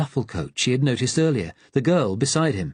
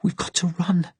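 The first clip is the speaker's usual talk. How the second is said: breathless; 8 semitones higher than usual. Acted. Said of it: "the girl said breathlessly".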